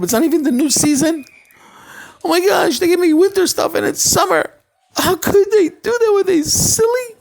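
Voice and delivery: high, loud, fast fretting; frantic complaining. Acted. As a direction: panic over the wrong-season clothes. Rich man frantic in street.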